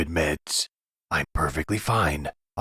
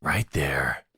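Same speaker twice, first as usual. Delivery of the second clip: hushed whisper pointing the way. Acted. Captor whispering directions down to the basement, hushed. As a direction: breathe it out under the voice.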